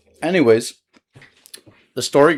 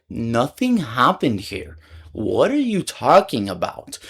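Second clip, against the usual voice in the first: slow, spelled-out protest; acted-out, deliberate disbelief; retold emphatic denial; slower than usual. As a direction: stretch every word to get it through. Reasoning with the angry woman.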